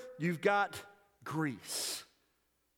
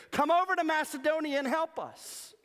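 high, loud beckoning cry; pleading call; 8 semitones higher, 3 dB louder than usual. Acted. The Macedonian man calling Paul across the sea, high and pleading. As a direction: call him over the water, urgently.

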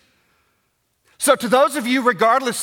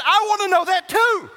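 mock shout; 8 semitones higher, 4 dB louder than usual. Echoing the complaint in earnest.

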